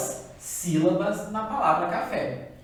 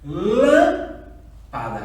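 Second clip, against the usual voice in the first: LÂM loud, high and stretched, PADA after a gap; calling the word; 4 semitones higher, 7 dB louder, much slower than usual. Shown as a demonstration. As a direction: call out "Lâmpada", leaning long on LÂM. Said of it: "se eu chamar a palavra".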